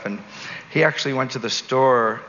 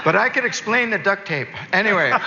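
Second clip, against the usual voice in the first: laughing; 7 semitones higher than usual. Real, not acted. Joking about duct tape.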